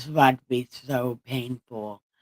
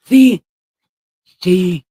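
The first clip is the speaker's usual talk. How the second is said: high, loud proud "See?"; excited; 4 semitones higher, 13 dB louder than usual. Real, not acted. Streamer proudly showing chat his better speech, high and loud. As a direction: exclaim it like proof.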